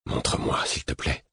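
breathy whispered plea; murmured whisper. A narrator's reading. Whispering a plea to the half-asleep man. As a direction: whisper the request so as not to wake him. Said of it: "au murmure de ma voix".

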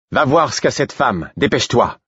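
officer calling out orders; urgent order. A narrator's reading. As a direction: send the soldier off in haste. Officer shouts order to soldier.

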